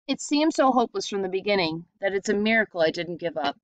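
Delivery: plain reading voice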